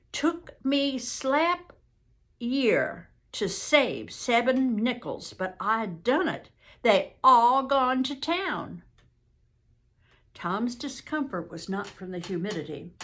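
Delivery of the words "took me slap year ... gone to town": slow, drawn-out Southern quote; earnest witness. Acted. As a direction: retell her words slowly in rural Southern dialect. Witness quotes the young woman.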